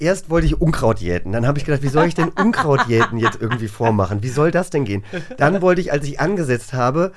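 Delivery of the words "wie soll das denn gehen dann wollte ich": ordinary delivery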